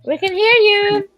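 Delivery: high-pitched call down the line. Real, not acted. Telling Fred they can hear him, calling out.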